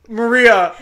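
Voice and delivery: loud retold call to a friend; calling for help. Drunk friend trying to wake Maria, calling for help. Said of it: "calling for help".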